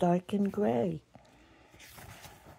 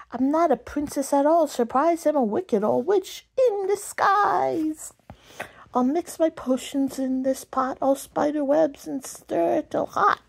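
witch voice; 7 semitones higher than usual. Acted. Wicked old witch in disguise.

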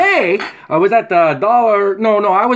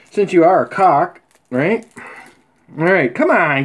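ordinary talk, nothing marked

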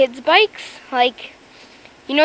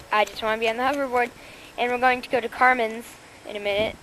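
usual speaking voice

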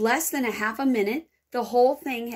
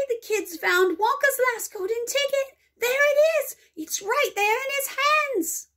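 much higher, louder excited screaming; 4 dB louder than usual. Acted. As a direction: shriek it to the whole shop. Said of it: "screamed the shopkeeper".

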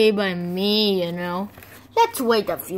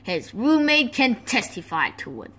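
usual speaking voice

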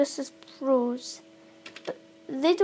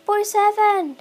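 calling out; 5 semitones higher, 8 dB louder than usual. Real, not acted. Calling out a friend's name in-game.

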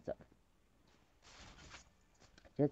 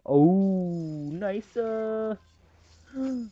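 usual speaking voice

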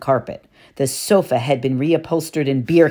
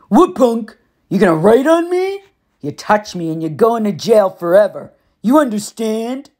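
higher, louder sneering bully; taunting Gabe; 6 semitones higher, 4 dB louder than usual. A narrator's reading. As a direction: taunt the kid holding a pen. Gabe voiced in narration.

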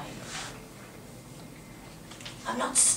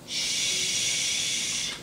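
shushing. Acted. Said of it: "Why do you keep shushing me?".

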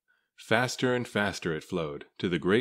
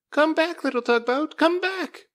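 put-on high, loud child calling after the boat; crying out; 6 dB louder than usual. A narrator's reading. Little boy calling after Scuffy downstream, high and loud. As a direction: call desperately after the drifting boat. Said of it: "cried the little boy".

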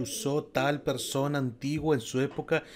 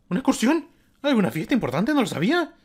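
puzzled schoolgirl; 9 semitones higher, 7 dB louder than usual. Acted. Kyoko wondering where everyone went.

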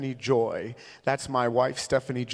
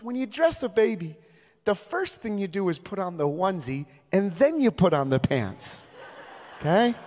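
high mock-patient explaining; passive-aggressive nice; 6 semitones higher than usual. Mom teaching a dad to dress a baby, passive-aggressive nice. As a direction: imitate a mom politely spelling out the obvious. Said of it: "super passive aggressive".

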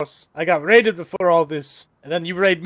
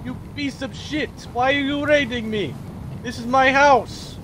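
normal talking voice

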